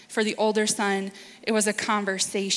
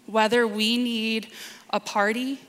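ordinary talk, nothing marked

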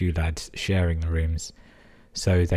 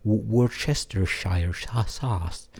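slow, halting American try at Worcestershire; sounding out Worcestershire sauce slowly in a mock American accent; mock American stumbling; 4 semitones higher, much slower than usual. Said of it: "You can barely say Worcestershire".

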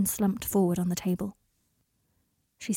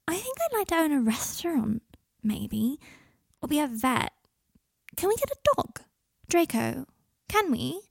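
put-on higher, lightly girlish drunk chatter; drunk teenage girl; 5 semitones higher than usual. Tipsy Adelaide chattering at Draco over pasta, higher girlish voice. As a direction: babble it up high like a tipsy kid.

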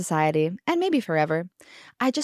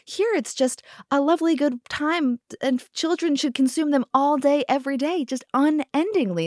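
higher, mock-cheerful sarcasm; sarcastic; 7 semitones higher than usual. Mom lecturing an English six-year-old, mock-cheerful sarcasm. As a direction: sing it out in fake delight. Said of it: "And I was like".

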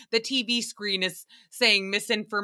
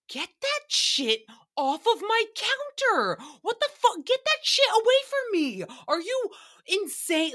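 very high-pitched squeal of disgust; hammed-up freak-out, voice shot way up; mock horrified outburst; 11 semitones higher than usual. Desk clerk to guest with a bed bug, mock horrified.

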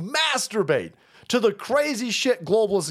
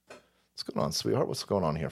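mock seductive hush; 13 semitones lower, 8 dB quieter than usual. Mock sultry greeting.

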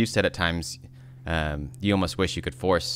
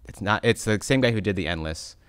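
usual speaking voice

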